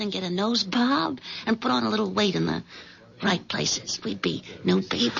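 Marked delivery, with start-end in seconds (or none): 4.0-5.2 whisper. Acted. Sherry whispering their future.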